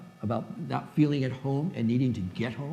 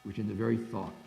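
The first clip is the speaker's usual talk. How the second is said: reading the translation; 3 semitones lower than usual. Shown as a demonstration. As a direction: read the verse aloud to the class. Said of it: "here's how he translated".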